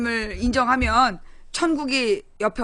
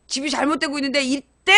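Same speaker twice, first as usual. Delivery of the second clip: usual speaking voice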